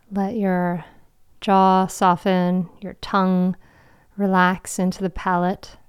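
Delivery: guided meditation. Acted. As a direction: guide them into calm, with long pauses.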